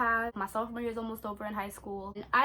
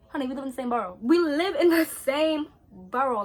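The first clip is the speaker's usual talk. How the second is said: sassy emphasis; 6 dB louder than usual. Real, not acted. Sassy repetition.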